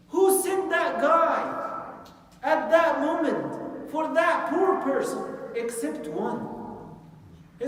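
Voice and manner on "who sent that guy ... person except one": awed exclamation. Real, not acted. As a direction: marvel aloud at the timing. Preacher marvels at divine timing.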